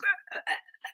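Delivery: very high, broken yelps; imitated choking cries. Man hit in the esophagus, imitated choking yelps. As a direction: gasp out small high cries.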